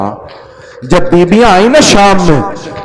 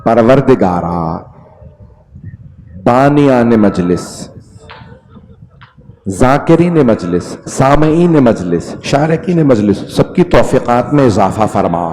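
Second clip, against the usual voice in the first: low, soft supplication; closing prayer; 7 semitones lower, 6 dB quieter than usual. Acted. Preacher closes the majlis with a soft prayer. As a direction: pray quietly for the gathering.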